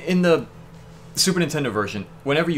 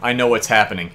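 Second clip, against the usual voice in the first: ordinary delivery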